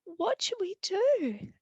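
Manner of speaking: high, squeaky, worried; frightened little mice. Acted. Little mice asking their mother for help, high and frightened. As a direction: plead to mum in a tiny voice. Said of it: "And they said".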